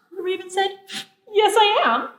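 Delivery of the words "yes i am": higher, louder "Yes, I am" in one quick go; cocky comeback, pitched up; insistent retort. A narrator's reading. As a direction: insist right back in a childish squabble. Raven argues back at bear.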